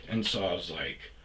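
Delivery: ordinary talk, nothing marked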